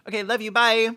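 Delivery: ordinary talk, nothing marked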